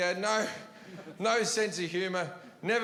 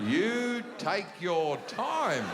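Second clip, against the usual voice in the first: drawn-out resignation; 2 semitones higher, slower than usual. Retold or imitated. Quoted resigned advice, drawn out.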